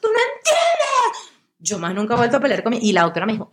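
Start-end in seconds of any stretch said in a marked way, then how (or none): 0.0-1.3 high, slow, laughing yell; mock tantrum yell. Yelling at her mom during anxiety fights, laughing shout. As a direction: wail it like a teen storming off. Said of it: "la pobre quedaba".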